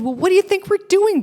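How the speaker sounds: high, loud challenge; Bruce challenging. Retold or imitated. Bruce challenging the journalist's approach. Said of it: "And he said".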